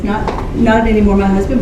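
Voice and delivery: ordinary talk, nothing marked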